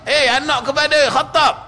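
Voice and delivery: a rough desert man insulting the caliph; rude call. Retold or imitated. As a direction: hurl the father's name at him. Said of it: "Tak panggil gitu. Panggil nama ayah".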